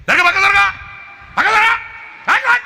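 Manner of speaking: high loud yell to move; shouting move aside. Retold or imitated. Preacher yelling at the boy to step aside, high loud. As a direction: bark him out of the way. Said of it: "అని ప్రాణానికి తిప్పానే".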